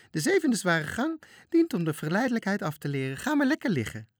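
high, loud cackling lady's voice. Acted. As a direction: relish it like a sly hostess. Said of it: "kakelde de dame". (hoge kakelende damesstem)

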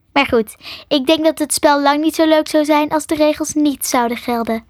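ordinary delivery

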